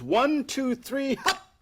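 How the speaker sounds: high, showman's count to the magic hop; magic words. Shown as a demonstration. Magician to the audience, saying the magic words with flair. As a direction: announce it like a stage magician. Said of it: "say the magical words".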